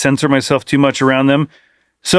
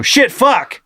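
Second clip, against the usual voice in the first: high, sudden mock blurt; Tourette's-like blurting; 7 semitones higher than usual. Dad imitating his son cussing mid-conversation, sudden high blurting. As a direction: blurt the swears out of nowhere. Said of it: "Like he has Tourette's or something".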